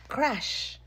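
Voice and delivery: crash. A narrator's reading. Sudden noise in story.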